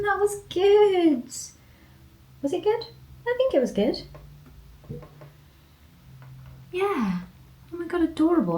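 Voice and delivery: high, soft sing-song pet voice; sweet high voice for a dog who won't give her paw. Real, not acted. Coaxing the dog into the clay.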